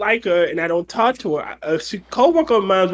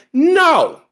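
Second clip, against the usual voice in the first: mock defiance, higher and louder; defiant retort; 7 semitones higher, 3 dB louder than usual. Telling off the boss at work, a defiant mock shout. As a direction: throw it back at the boss. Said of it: "I'm like".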